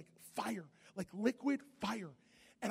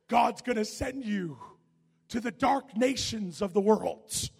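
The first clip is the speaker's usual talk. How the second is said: holy lady; 4 semitones higher, 11 dB louder, slower than usual. Retold or imitated. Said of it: "she's like".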